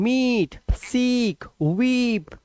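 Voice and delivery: मीट, सीक, वीप with high, held ee; long vowel. Shown as a demonstration. Teacher correcting the short reading, long ee in मीट, सीक, वीप. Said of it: "long vowel sound".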